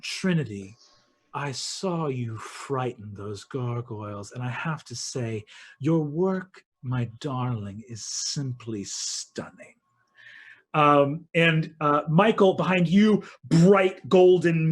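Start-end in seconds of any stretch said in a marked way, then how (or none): 0.0-9.7 low, soft, approving queen; silky quiet compliment, slowing at the end; Queen of Midnight. Acted. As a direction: praise her in a hushed, lower voice. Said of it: "Queen of Midnight, who smiles and says".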